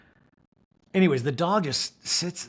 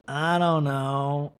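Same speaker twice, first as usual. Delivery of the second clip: drawn-out mumble of "I don't know"; slow mumbled I don't know; much slower than usual. Retold or imitated. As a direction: let it trail out, very slow. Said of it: "Robert Junior just goes".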